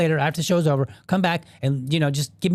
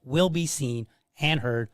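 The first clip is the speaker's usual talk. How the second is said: softer, steady sponsor read for the podcast; 5 dB quieter than usual. Acted. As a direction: read the promo lines quietly and smoothly.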